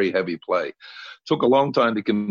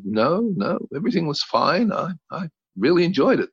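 higher, breezy mother voice; mother insisting all was fine, higher; mother's reassurance; 8 semitones higher than usual. Retold or imitated. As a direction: brush it off lightly as mom. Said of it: "And she said".

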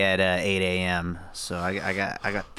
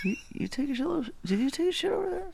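much higher, quiet, fast mock cop question; 5 dB quieter, much faster than usual. Co-host echoing the cop bit, high and mocking. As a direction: squeak the cop's line back. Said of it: "a really serious voice".

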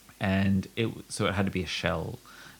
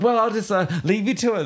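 flustered exit; 12 semitones higher, 3 dB louder than usual. Retold or imitated. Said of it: "I'm like".

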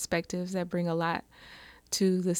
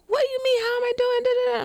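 very high, loud indignant shout; retold yelling; 5 dB louder, faster than usual. Furious mother yelling at the helpful stranger, very high shout. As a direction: shriek it at him, outraged. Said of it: "I'm just yelling, screaming at this guy".

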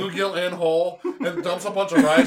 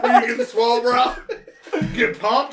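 mock gym bro; 6 semitones higher, 4 dB louder than usual.

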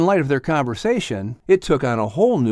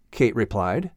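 ordinary delivery, nothing marked

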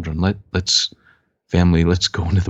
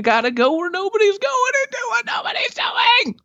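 high shouted tagline. Acted. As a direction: belt the tagline out.

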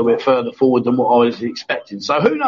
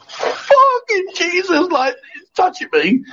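much higher, very slow squeal of disgust; mock panicked homophobe; much slower than usual. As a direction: ham up a burly guy freaking out. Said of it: "guys that are proper homophobic, go".

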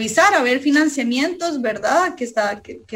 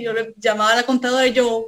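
normal talking voice

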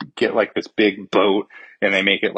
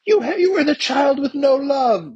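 high, strained mock villain line. A villain taunting the young hero, mock-voiced high. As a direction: sneer it in a pitched-up cartoon voice. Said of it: "he's like".

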